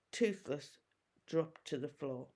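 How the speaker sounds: usual speaking voice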